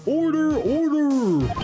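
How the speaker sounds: bang the gavel and call order; judge calling order. Acted. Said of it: "the judge's voice that I made".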